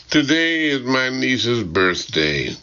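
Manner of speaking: ordinary delivery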